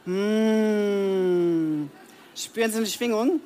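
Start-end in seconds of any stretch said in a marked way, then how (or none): 0.0-1.8 long relishing Mm hum. Shown as a demonstration. Coach humming with delight as example. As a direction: savour the hum with pleasure. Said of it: "summen Sie noch mal so richtig mit Wollust".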